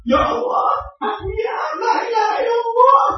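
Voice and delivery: put-on high-pitched little girl chanting; imitated child, rising at each ending; imitating small daughter. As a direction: lift it high, like a child's sing-song dhikr. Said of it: "anak saya kecil yang perempuan ini lucu".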